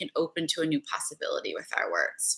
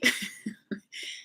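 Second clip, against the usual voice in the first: soft laugh; 2 semitones higher, 7 dB quieter than usual. Real, not acted. Laughs at seeming crazy.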